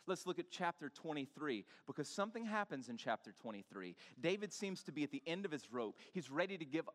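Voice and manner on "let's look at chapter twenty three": usual speaking voice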